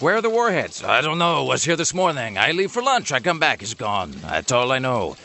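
a hint of a tipsy Russian guard shrugging; Russian accent. Joking about missing warheads, a light drunk Russian accent. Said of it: "Your Russian accent is awesome".

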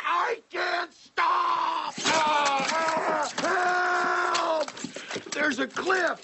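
high, slow panicked yelling; panicked shout. Acted. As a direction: scream for help as the brakes fail. Driver panics, brakes fail.

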